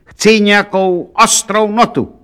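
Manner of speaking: high, demanding shout. A narrator's reading. Count demanding sheet music from saleswoman, shouting. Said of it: "křičel na prodavačku".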